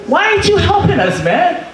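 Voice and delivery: high incredulous complaint; exasperated. Retold or imitated. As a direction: scold a friend found asleep. Confronting sleeping friend in car.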